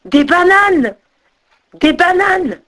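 high, delighted exclamation; thrilled high-pitched call; excited cry. A narrator's reading. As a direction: cry out at a lucky find. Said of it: "il s'écria".

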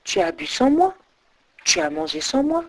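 hushed, pointed little voice; murmur. A narrator's reading. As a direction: murmur it softly, as a quiet reckoning. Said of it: "et murmura".

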